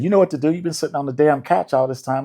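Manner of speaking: imitating bossy producers. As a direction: rib him like a boss telling him to get off the couch. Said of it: "They said".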